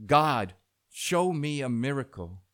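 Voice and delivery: loud, high demand to God; shouted plea. A narrator's reading. Man begs God for a miracle, shouting. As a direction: shout it up to the heavens. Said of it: "the man shouted all the louder".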